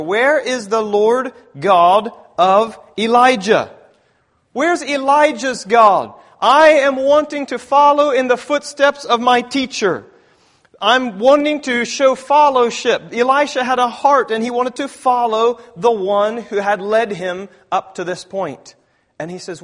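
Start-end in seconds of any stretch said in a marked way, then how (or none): 0.0-6.1 loud, slow and higher, calling out the question; slow booming plea to Elijah's God; crying out. Acted. Preacher repeats Elisha's cry. 6.4-18.7 loud, higher preaching voice; fervent pulpit delivery. Acted. Preaching at full force.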